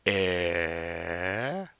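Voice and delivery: air, a throaty French R with mouth still; French R demo. Shown as a demonstration. Teacher models French R for class, throaty. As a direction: growl air in the throat, mouth not moving. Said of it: "We do the".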